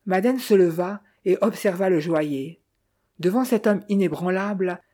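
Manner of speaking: usual speaking voice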